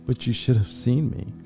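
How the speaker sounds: ordinary delivery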